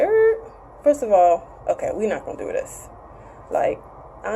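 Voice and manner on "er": high, sharp "er" cutting her off; a clipped grunt to shut her down; grunt of refusal. Retold or imitated. Man cutting off her small talk with a grunt. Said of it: "He was like".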